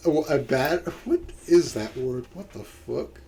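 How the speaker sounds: normal talking voice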